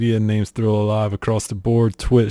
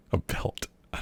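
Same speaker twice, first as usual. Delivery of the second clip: whispered laugh; 13 dB quieter than usual. Real, not acted.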